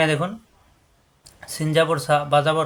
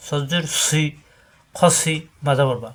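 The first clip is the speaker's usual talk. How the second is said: normal talking voice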